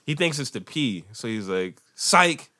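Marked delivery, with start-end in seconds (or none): 1.9-2.5 higher mock kid yell; son's yelled punchline. Son trying the joke again, lightly yelled. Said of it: "hearing him yell that".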